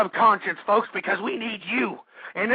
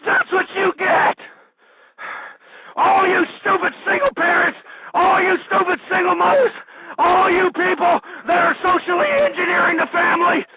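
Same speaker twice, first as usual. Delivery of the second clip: angry yelling; 9 semitones higher than usual. Real, not acted. Host yells at single parents he blames, furious. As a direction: lash out at them.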